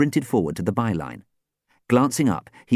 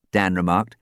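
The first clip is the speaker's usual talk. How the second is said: ordinary delivery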